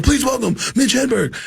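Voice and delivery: high-pitched hyped introduction; high energy. Retold or imitated. Opener introducing the headliner on stage, mock high energy. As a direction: bring on the headliner in a rush. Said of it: "I do my high energy".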